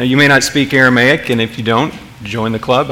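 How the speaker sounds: ordinary talk, nothing marked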